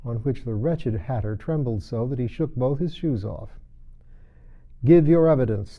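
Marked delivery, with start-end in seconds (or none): 4.8-5.8 loud stern angry demand. Acted. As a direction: bark the order at him. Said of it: "the king repeated angrily".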